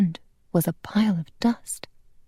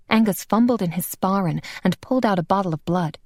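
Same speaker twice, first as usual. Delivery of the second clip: ordinary delivery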